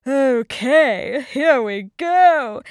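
loud, drawn-out wolf voice; wolf gearing up. A narrator's reading. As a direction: announce it big and slow. Wolf readies to blow.

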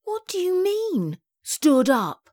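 high demand with falling end; disbelieving. A narrator's reading. Daughter questions Mum's news, disbelieving. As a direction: demand an explanation, pitched high.

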